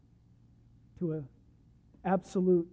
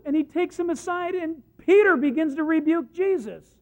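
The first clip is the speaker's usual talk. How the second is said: loud, high, driving sermon voice; full-force preaching; 7 semitones higher, 7 dB louder than usual. Acted. Preacher to congregation, loud high full-force preaching. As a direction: preach it hard to the hall.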